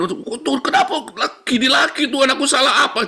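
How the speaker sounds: loud, very high-pitched mock wail; complaining prayer. Shown as a demonstration. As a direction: protest to God in an aggrieved, hammed-up cry. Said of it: "Jangan, jangan". (meratap protes dengan nada melengking)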